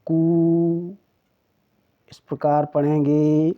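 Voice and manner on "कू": कु with a long, held oo; teacher sounding out long koo in कु; pronunciation demo. Shown as a demonstration. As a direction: stretch कु out as koo on a level note. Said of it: "तो इसका उच्चारण".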